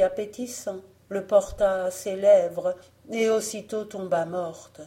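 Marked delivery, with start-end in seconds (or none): none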